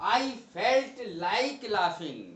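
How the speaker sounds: very slow, word by word; slow dictation. Shown as a demonstration. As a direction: lay each word down slowly for students. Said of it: "इसको भी समझना बहुत जरूरी है".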